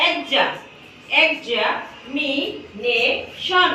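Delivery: Examination slowly broken into its syllables, এক্সা first; slow syllable split. Shown as a demonstration. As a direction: sound out examination one syllable at a time. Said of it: "ভাগ করছি, দেখো". (ধীরে ধীরে syllable ভেঙে বলা)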